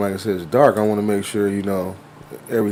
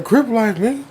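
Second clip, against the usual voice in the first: a little kid acting tough; mock kid bravado; 11 semitones higher than usual. Kid throwing up a gang shout at the driver, mock tough.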